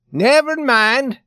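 very high, loud, drawn-out; big hollering character voice; blustering Swede. A narrator's reading. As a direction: brush him off at a shout. Swede brushes off gambler's refusal.

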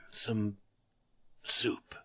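soft, faraway whisper; dreamy whisper. Acted. Tattered man dreaming of food, a soft dreamy whisper. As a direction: breathe it out like a wish. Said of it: "he repeated dreamily".

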